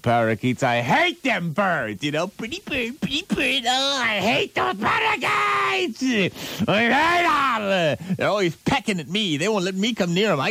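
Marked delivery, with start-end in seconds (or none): 2.3-3.6 high squawky pretty bird; parrot voice. Retold or imitated. Mimicking parakeet talk. 3.7-8.2 high, slow put-on rant. Ranting about aunt's parakeets.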